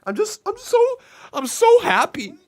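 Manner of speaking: high-pitched, hammed-up happy weeping; mock crying. Streamer with watering eyes joking to viewers, mock crying. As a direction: fake being overcome with tears, loud and high, easing off at the end. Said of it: "Con Man's crying".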